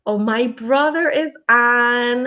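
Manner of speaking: usual speaking voice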